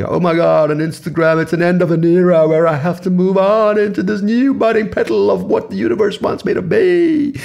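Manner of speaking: high, loud, gushing mock-dramatic voice; over-the-top theatrical gushing; dramatic overshare. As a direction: ham up a melodramatic Instagram post. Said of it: "you don't have to be aggressive and dramatic and say".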